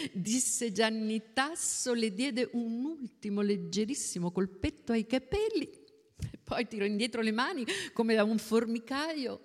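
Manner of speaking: ordinary delivery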